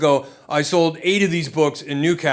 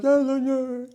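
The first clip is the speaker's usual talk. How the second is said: put-on much higher, a laughing child brushing it off; dismissive kid; 10 semitones higher, slower than usual. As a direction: toss it away like a bored toddler. Toddler brushing dad off.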